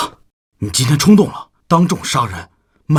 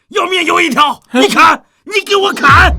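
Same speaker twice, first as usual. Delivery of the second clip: high, loud dare; defiant shout; 8 semitones higher, 4 dB louder than usual. Acted. Father refusing to pay, daring him, defiant shout. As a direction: build to a shouted challenge to strike.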